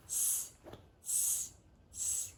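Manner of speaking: soft breathy tsk tsk tsk; cheeky monkeys tutting back. A narrator's reading. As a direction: monkeys mocking the peddler with soft tuts. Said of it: "and said".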